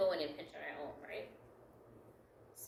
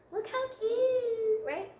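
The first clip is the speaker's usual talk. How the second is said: very high, slow, louder gushing squeal; cutesy squeal; 7 dB louder, much slower than usual. Acted. Crafter admiring her own flower layer, in a high, slow, cutesy squeal. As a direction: coo it like it's adorable.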